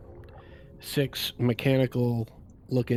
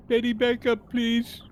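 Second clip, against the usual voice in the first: high-pitched polite call; in-character order; 11 semitones higher than usual. Acted. Player asking companion to back up, high-voiced request.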